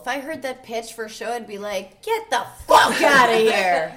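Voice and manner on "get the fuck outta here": loud, drawn-out shout; hammed-up incredulous yell; mock outraged dismissal. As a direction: throw the pitch out at full volume. Imagined reaction to a show pitch.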